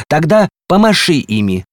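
high, loud storybook demand. A narrator's reading. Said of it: "требовал горшок с геранью". (требовательно, громко и высоко)

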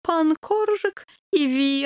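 put-on slow, high, accented Пан Коржик и ви; Коржик drawn out, accented ви; dying dog king. A narrator's reading. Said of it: "заговорил героический доберман".

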